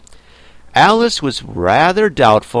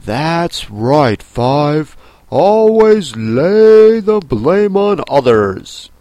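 sore loser; 3 semitones higher, 5 dB louder, slower than usual. Acted. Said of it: "Seven looked up and said".

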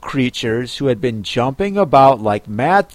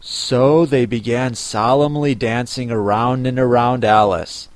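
ordinary delivery, nothing marked

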